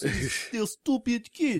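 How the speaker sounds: slow mock Russian accent. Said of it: "That's much better Russian ac".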